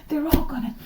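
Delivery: words breaking into a laugh. Real, not acted. Tarot reader cracking up at her own prediction.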